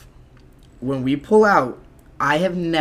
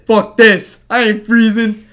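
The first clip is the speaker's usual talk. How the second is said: louder, higher defiant yell; 7 semitones higher, 9 dB louder, slower than usual. Retold or imitated. Defying the cop before running, louder. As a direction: shout it back as you bolt.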